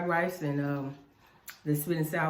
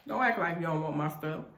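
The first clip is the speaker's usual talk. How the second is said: normal talking voice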